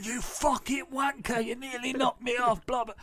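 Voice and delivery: retelling the cyclist's abuse in a mock shout; mock angry cyclist. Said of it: "this guy's turned around".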